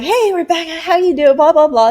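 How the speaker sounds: high, chirpy imitation of a caller; breezy phone caller.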